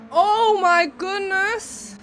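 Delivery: high, slow shocked exclamation; surprised. Real, not acted. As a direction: gasp it out in disbelief. Shocked at instant sabotage.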